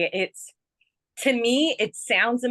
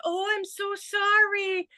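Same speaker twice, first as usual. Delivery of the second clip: high, gushing sympathy; sympathetic apology; 13 semitones higher than usual. Retold or imitated. To a niece who ran and fell, high sympathetic cooing. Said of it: "We feel sorry for you".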